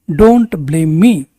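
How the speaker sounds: very slow, stretched words; slow, clear repetition. Shown as a demonstration. Teacher repeating for students, slow and deliberate. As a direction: lay out every word slowly. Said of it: "teacher models sentence twice".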